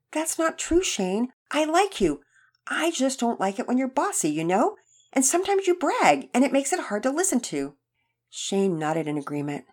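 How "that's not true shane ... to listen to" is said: higher-pitched, lightly voiced earnest boy; reassuring boy. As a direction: reassure a friend in a lifted voice. Boy earnestly reassures his hurt teammate.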